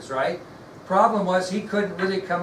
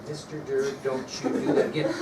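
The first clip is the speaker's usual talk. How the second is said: pretend reprimand; 2 semitones lower than usual. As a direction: act out a mild warning to the teacher. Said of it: "The R word".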